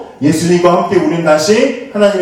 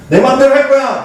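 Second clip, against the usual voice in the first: put-on defiant teen; 8 semitones higher than usual. Teen storming out, a hint of mock defiance. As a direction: toss it off like a stubborn teenager. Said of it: "아까 말한 것처럼".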